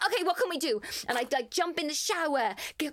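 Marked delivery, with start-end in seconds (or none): 0.0-0.8 perky wake-up. Retold or imitated. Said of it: "being like".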